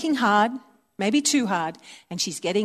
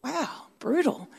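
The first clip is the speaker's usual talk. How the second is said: mock sarcasm; 4 semitones higher, 8 dB quieter, slower than usual. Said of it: "it's like".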